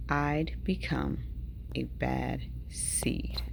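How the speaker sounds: low, slow, sinking into a whisper; ominous. A narrator's reading. Seed confesses what he has become, slow ominous near-whisper. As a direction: let it darken and fade word by word. Dark turn in the story.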